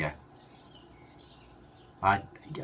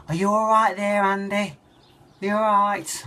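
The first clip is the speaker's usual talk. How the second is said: much higher, louder mock mum voice, anxious; imitating mum; 5 dB louder than usual. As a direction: fuss like a worried mum. Mum voiced asking son.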